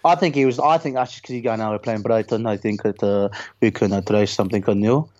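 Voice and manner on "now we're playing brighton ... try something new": low-pitched attempt at an Italian accent that lands Russian; depressed Italian manager in a muddled Russian-sounding accent; botched Italian accent, sounding Russian. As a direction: play a downbeat Italian, badly, like a Russian. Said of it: "turned into a fucking Russian".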